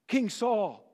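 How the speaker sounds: ordinary talk, nothing marked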